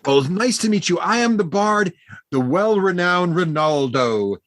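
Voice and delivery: high-pitched, effusive halfling greeting; cheery halfling bard. Acted. Halfling bard thanking new friends for a drink, high effusive voice. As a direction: play a gushing little bard, pitched high.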